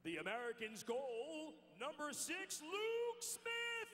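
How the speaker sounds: arena announcer. Acted.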